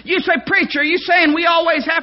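loud, high preached warning; preaching at full force. Real, not acted. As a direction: drive it home to the congregation. Sermon climax.

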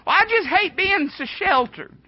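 high mock whine of a sheltered teen; whiny teenager. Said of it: "that's all you hear".

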